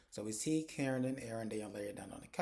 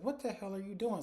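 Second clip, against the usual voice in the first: higher-pitched, indignant demand; retold outrage; 8 semitones higher than usual. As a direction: act out a mother catching her daughter kissing. Mother walks in on kissing.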